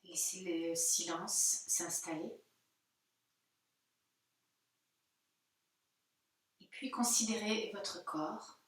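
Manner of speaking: hushed, spacious guiding voice, long pauses; guided meditation. Real, not acted. Yoga teacher guiding listeners into their body, soft meditation voice. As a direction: ease them gently into stillness.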